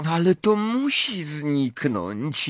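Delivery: high, slow, plaintive wail; moaning. A narrator's reading. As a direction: groan it out in dismay. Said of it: "jęknęła Hermiona".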